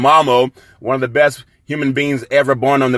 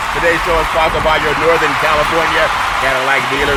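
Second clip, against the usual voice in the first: normal talking voice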